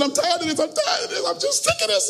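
high, soft mock-tearful whine, rising as it goes; crying devil. Satan whines to God outside a church, mock crying. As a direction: build a hammed-up pitiful complaint to a squeal. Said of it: "and he's crying".

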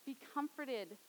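Jesus to the widow, soft and compassionate; compassionate plea. Acted. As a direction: reach out tenderly. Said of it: "this compassionate heart saying".

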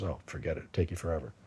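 low, soft, offhand brush-off; casual dismissal. Retold or imitated. Friend at work dismissing the idea of learning Vietnamese, casually. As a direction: imitate a friend tossing it off under his breath. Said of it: "just casually said something".

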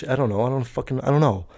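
ordinary delivery

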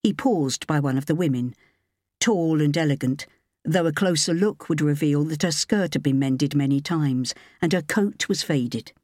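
ordinary delivery, nothing marked

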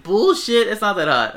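loud retort, starting high and easing down; exasperated retold rebuttal; indignant comeback. Retold argument, his comeback.

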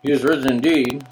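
low, listless congregational reply; bored mumble. Shown as a demonstration. Congregation repeating back to the pastor, bored mumble. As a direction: drone it back without conviction. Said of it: "it's gonna sound all mumbled".

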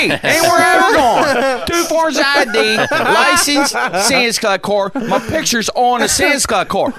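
the customer insists her Sam's Club card counts; indignant customer. Retold or imitated. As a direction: play the outraged customer waving her ID. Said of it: "True story".

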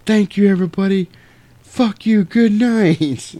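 high, strained stage yell; mock rock-star sign-off. Band blowing up says farewell to audience, mock yell. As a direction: call it out to a concert crowd.

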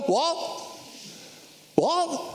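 high-pitched rising disbelief; incredulous Peter. Acted. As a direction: play a disciple thrown by the news. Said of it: "Read it through Peter's eyes".

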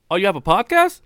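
high, curious retold question; surprised question. Other truck driver asks about the podcast, surprised. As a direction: imitate a coworker's perked-up surprise.

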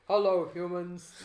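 slow, deep alien overlord. Acted. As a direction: boom it out like a cartoon alien. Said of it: "like the one from The Simpsons".

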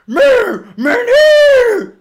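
very high, loud, crying monster yell; creature wail. Acted. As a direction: howl it in pain and rage.

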